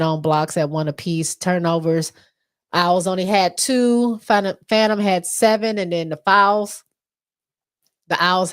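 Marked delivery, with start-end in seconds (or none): none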